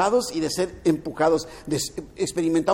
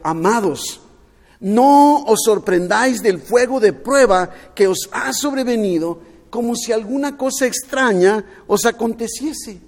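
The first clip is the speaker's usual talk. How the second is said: lectura bíblica proclamada; 2 semitones higher, 6 dB louder than usual. A narrator's reading. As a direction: read Scripture loudly, with weight on each phrase. (lectura enfática en voz alta)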